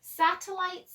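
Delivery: plain reading voice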